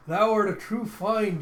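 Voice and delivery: high, loud, slow archaic proclamation; theatrical delight. Retold or imitated. Byron praising the young intruder, loud theatrical delight. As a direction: declare it grandly, delighted.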